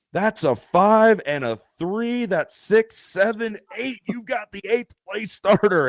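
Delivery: high, slow, carefully counted announcer call; on-air counting. Retold or imitated. Announcer adding up dice aloud on broadcast, high and deliberate. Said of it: "and I'll go".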